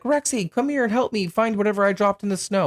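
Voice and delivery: high, urgent retold plea to a dog; calling the dog. Movie character asking his dog for help, high mock plea. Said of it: "He's like".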